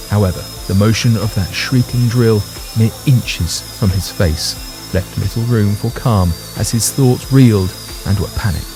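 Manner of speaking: ordinary delivery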